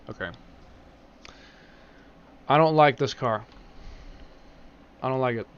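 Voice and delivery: usual speaking voice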